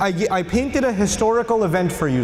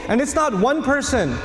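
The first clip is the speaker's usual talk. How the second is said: usual speaking voice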